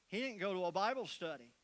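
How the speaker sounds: normal talking voice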